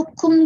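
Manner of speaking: कुम said as koom, short u, with a clear k; pronounced kum. Shown as a demonstration. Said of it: "कुम करके pronounce करते हैं".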